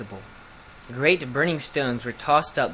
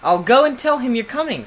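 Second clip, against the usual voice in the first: high, loud parting call; 10 semitones higher, 6 dB louder than usual. A narrator's reading. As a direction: call it down brightly while leaving. Prince calls out to the drake.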